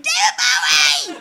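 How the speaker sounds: high, loud, slow shout of joy; joyous shout. Retold or imitated. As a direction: shout it into their faces with delight. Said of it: "joyously shouting".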